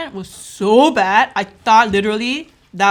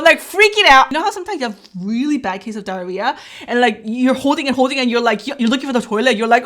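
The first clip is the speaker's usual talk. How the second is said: ordinary talk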